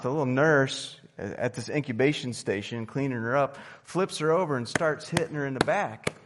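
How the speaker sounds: ordinary delivery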